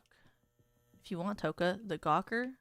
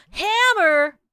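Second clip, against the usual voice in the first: loud, much higher squeal of surprise; surprised shout; 11 semitones higher, 14 dB louder than usual. Real, not acted. Player finds a hammer, shocked loud squeal. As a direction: yelp the word in shock.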